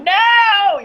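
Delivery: high, loud dismayed cry; anguished no. Retold or imitated. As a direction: protest the news. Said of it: "I'm like".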